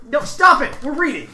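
loud, high scolding; exasperated shout. Real, not acted. Host scolding co-hosts while reading mail, exasperated shout. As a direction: shout down the interruption.

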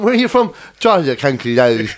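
mock County Louth accent, tongue barely used; paralyzed-tongue Louth accent. Showing the lads a Louth accent, slack-tongued caricature. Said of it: "you don't use your tongue".

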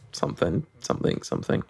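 mumbled. Real, not acted. Mumbling a vague filler.

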